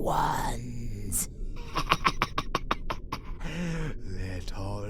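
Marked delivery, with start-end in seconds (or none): 1.3-4.0 mocking breathy chuckle. Villain mocks Little light.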